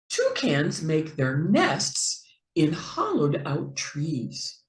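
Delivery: usual speaking voice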